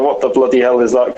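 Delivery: ordinary talk, nothing marked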